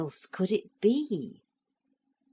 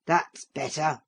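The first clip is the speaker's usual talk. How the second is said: big voice. A narrator's reading. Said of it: "quite a loud, strong, big voice".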